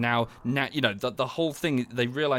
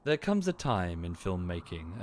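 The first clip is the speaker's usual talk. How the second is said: slow, lower, mock-grave narrator; a light take on a solemn essayist; imitating Mauler; 5 semitones lower, 4 dB quieter than usual. Quoting the YouTuber's breakdowns, slow mock-solemn narration. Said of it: "he sort of says".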